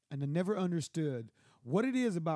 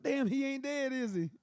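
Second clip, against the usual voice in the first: surprised; 8 semitones higher than usual. Retold or imitated.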